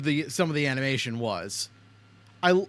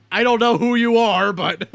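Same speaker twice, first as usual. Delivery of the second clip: loud laugh through the words; cracking up mid-sentence; 7 semitones higher, 10 dB louder than usual. Real, not acted. Laughing at a character's cameo.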